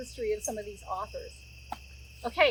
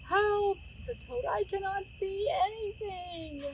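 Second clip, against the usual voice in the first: dazzled Toad; 9 semitones higher than usual. A narrator's reading.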